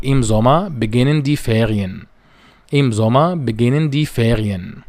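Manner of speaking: am nächsten Sonntag said very slowly and carefully; slow German demo. Shown as a demonstration. Teacher models German sentence two for students, very slowly. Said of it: "الجملة الخامسة".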